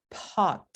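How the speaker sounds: "pot" with a strong puff of air after the p; "pot" with a heavy h-like burst after the p; exaggerated aspirated p. Shown as a demonstration. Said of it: "I'm exaggerating it now".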